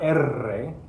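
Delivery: "R" as erre with a long, exaggerated trill; overdone roll. Shown as a demonstration. Teacher showing students what not to say, overboard rolled R. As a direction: draw out the trill in "R", slowly overdone. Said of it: "It's not R".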